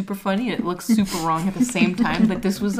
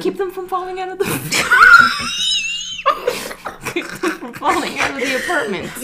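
laughing; 10 semitones higher, 5 dB louder than usual. Real, not acted. Laughing through the story.

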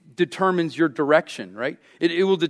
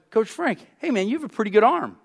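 put-on higher, quick eager kid voice; kid's compliment; 6 semitones higher, faster than usual. Seven-year-old praises coach's throwing arm, eager higher kid voice. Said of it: "He's like".

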